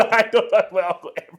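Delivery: loud, high, laughing through the words; laughing admission. Real, not acted. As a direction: confess the bluff while cracking up. Laughs admitting the name-drop was bluff.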